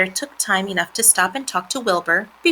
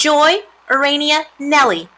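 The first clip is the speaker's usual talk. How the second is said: higher, louder formal address; speech-making pig; 8 semitones higher, 6 dB louder than usual. A narrator's reading. Wilbur addresses the three spiders, higher ceremonious voice. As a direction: announce each name as the pig.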